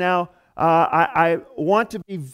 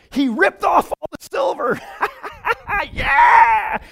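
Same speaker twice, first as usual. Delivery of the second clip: excited shout; 9 semitones higher than usual. Real, not acted. Preacher shouting the story to the congregation, excited. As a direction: let it burst out, laughing. Excited retelling of Les Miserables.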